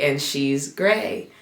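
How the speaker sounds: normal talking voice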